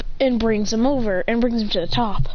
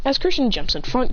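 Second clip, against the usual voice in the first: plain reading voice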